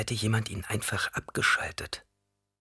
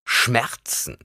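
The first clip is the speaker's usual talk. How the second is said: put-on scornful old woman, higher pitch; contemptuous; 4 semitones higher than usual. A narrator's reading. Reverend mother dismisses the boy's complaint, contemptuous. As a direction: throw the word back with contempt. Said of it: "erwiderte sie verächtlich".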